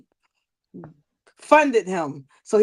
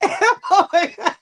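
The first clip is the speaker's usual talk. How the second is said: laughing; 8 semitones higher than usual. Real, not acted. Laughing at a yell.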